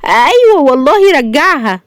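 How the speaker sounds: high, drawn-out assurance; earnest daughter. A narrator's reading. Daughter nodding to her stunned father, earnest assurance. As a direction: insist slowly in a high voice. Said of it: "تهز شاهندة راسها وتقول".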